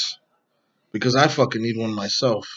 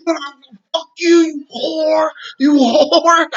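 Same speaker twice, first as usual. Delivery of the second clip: high, loud hammed-up comeback; mocking shout; 6 dB louder, much slower than usual. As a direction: yell it back in a mocking high voice. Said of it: "so I was making fun of her ass".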